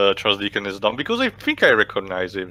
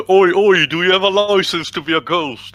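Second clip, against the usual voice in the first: British cop voice; 7 semitones higher, 3 dB louder than usual. Pretend. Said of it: "No, no, no, you have to do it in the Welsh accent".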